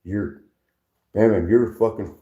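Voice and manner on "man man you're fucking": ordinary talk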